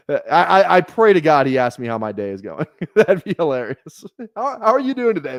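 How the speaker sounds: talking through laughter; laughing. Real, not acted. Streamer hoping the trade partner asks about his day, laughing. Cracking up imagining the chat.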